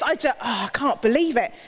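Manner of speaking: normal talking voice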